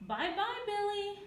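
high, loud singsong call; gleeful-sounding shout. A narrator's reading. Bullied schoolmates to Billy, shouting goodbye. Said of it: "schoolmates simply shout".

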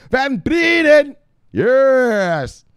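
high, drawn-out shout; hyped shout-out. Pretend. Hailing a viewer who joined the show, hyped shouting. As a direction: stretch the name into a gleeful holler.

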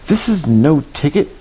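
lightly acted high-pitched refusal; a touch of storybook indignation; indignant ticket man.

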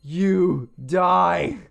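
why won't you die, shouted; frustrated yell. Real, not acted. Frustrated gamer yelling at enemy.

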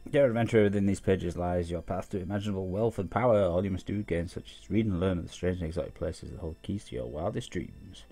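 loud, grand reading voice; book read aloud. Acted. Player reading an adventure book to viewers, grandly. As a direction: proclaim it like an advertising pitch.

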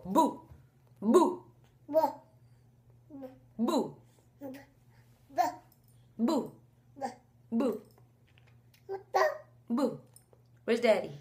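put-on a light, playful toddler boo, repeated; imitating baby's boo. Mom imitating her son scaring people, lightly. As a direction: mimic the baby trying to scare you. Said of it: "He'll go".

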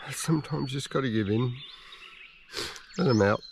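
quiet, soft, choked-up musing; holding back tears while speaking; emotional. Real, not acted. Alone in the bush, telling viewers about his dying dog, softly emotional. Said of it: "I'm feeling a little bit emotional".

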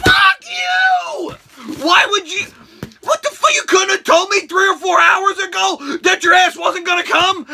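high, loud furious yelling; furious rant. Acted. Ranting at the friend who bailed, high furious yelling. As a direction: scream at him for bailing.

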